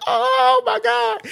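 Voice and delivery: high, slow, breathless amazement; drawn-out high awestruck exclamation; stunned oh my god. Retold or imitated. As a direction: gasp it out, floored. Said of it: "I said".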